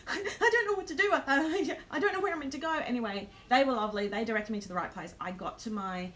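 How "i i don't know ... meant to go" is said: high, loud, fast tearful babble with a laugh; tearful panic. Retold or imitated. Lost traveller answering a kind staff member, tearful high babble. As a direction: sob it out, half laughing, lost and overwhelmed. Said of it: "I was in tears".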